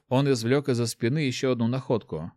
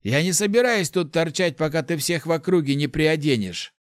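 necromancer; 6 semitones higher than usual. A narrator's reading. Necromancer scolding the boy.

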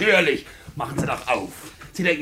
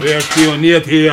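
slow, loud, indignant; indignant shout; 11 dB louder, slower than usual. Acted. Tenant accused of spying, shouting back indignantly. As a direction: bellow the question back at him.

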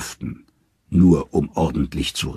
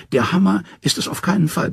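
much higher, nervous trickster voice; Loki, scared; 12 semitones higher than usual. Acted. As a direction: pitch up into a wary, scheming Loki. Narrator voices frightened Loki.

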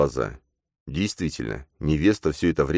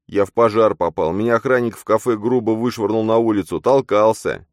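lamenting; 7 semitones higher, 5 dB louder than usual. Acted. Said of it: "стенал Матвей".

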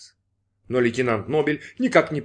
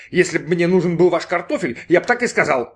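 irritated retort, higher and a bit louder; mildly snapping at someone; 6 semitones higher, 4 dB louder than usual. A narrator's reading. Brin rebukes the waiter over unwanted fries, mildly angry. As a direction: tell off the waiter, mildly cross. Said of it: "напустился на него Брин".

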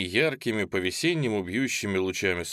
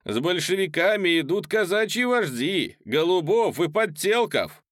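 heckler voice; 8 semitones higher, 5 dB louder than usual. A narrator's reading. Heckler answers the orator.

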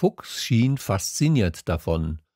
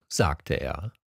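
ordinary delivery

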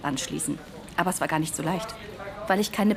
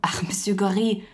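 hushed whisper. Acted. Surprised recognition, hushed aside.

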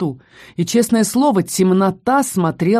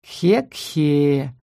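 eerie chuckle; 4 semitones lower than usual. A narrator's reading. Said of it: "прозвучало из темноты выемки".

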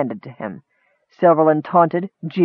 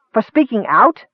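higher, indignant question; raised voice; 7 semitones higher than usual. Acted. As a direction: let the voice rise in disbelief. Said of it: "Errol realized his voice had risen".

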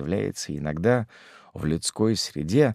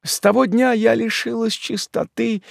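high, raised plaintive lament; anguished widow; 4 dB louder than usual. A narrator's reading. Widow pleads her innocence to Karl, high anguish. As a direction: plead your innocence to your love. Mother voiced by narrator.

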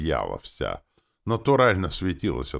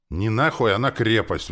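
loud, higher-pitched agitated retort; excited correction; 7 semitones higher, 7 dB louder than usual. A narrator's reading. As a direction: correct her excitedly and forcefully. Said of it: "возбуждённо поправил я".

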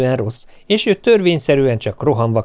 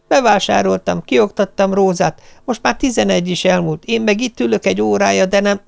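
higher, harried stream of excuses; nervous complaint; 8 semitones higher than usual. Retold or imitated. Wife explains to husband on phone, higher and harried. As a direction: pour out the excuses, frazzled.

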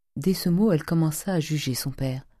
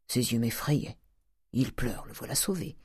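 muttered to himself; 2 semitones lower, 6 dB quieter than usual. A narrator's reading. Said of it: "se dit Grandet".

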